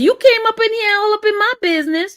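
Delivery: fast, high indignant rant. Real, not acted. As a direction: tell him off quickly.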